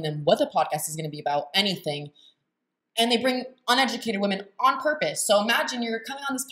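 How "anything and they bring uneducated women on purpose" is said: ordinary delivery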